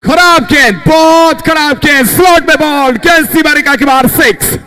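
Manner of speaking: high, loud call of a six; excited cricket commentary. Real, not acted. Calling a six.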